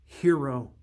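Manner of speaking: plain reading voice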